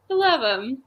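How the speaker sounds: ordinary talk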